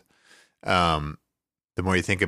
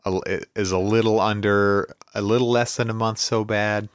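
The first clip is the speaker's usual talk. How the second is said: ordinary talk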